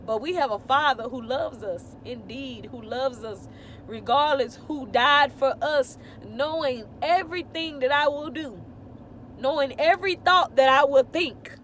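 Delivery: loud, high, building fervent testimony; preaching. Real, not acted. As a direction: preach it with full conviction. Testifying with rising fervour.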